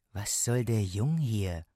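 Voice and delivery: slow, displeased workman's question; lightly voiced stonemason, drawn out; gruff stonemason. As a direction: ask it slowly, objecting to the boy. Stonemason objects to the boy.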